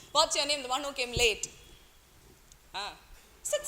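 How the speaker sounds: ordinary talk, nothing marked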